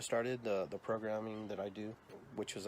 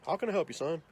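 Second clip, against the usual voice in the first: retold store clerk; 7 semitones higher, 5 dB louder than usual. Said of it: "Just like that".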